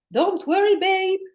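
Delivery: high, loud soothing soul voice; a big-hearted soul calling out don't worry; the soul cheerfully calming its anxious human, high and loud; soul reassuring. Acted.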